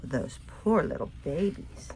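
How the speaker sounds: plain reading voice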